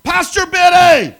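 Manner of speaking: high, loud, drawn-out yell of a name; lady yelling from her truck to the pastor; screaming his name. Retold or imitated. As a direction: call out across the driveway, stretched. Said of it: "starts screaming".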